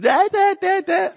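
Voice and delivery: high mock wife tutting at the mess; mock scolding wife. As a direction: squeak out her scolding noises.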